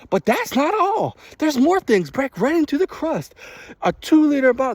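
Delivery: high, loud, hammed-up ad voice; mock announcer. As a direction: boom it like a TV announcer. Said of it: "the announcer's like".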